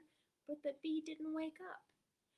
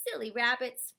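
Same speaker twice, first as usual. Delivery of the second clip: owl scolding; 3 semitones lower, 11 dB louder than usual. Acted. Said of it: "said the owl".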